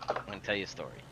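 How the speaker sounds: dropped low and soft, leaning in; soft, low confiding repeat; hushed storyteller. Shown as a demonstration. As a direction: pull them in with a near-murmur. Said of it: "repeats it hushed for effect".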